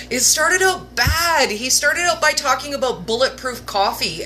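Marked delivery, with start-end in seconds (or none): 0.1-1.5 higher, slower, laughing. Real, not acted. Admitting to viewers, laughing.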